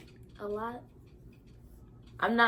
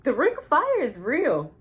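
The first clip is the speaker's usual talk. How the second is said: emphatic; 7 semitones higher, 12 dB louder than usual. Real, not acted. Emphatic pain claim.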